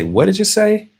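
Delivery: high, rising puzzled question; puzzled asking. Retold or imitated. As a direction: ask as if you missed it. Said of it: "slow down and say".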